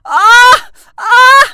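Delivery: loud hammed-up cries of pain; boy yelling in comic pain; pain yelps. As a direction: yelp out loud. Boy punched wall, hurt hand.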